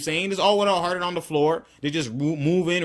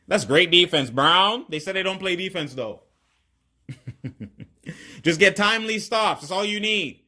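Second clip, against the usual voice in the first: ordinary talk, nothing marked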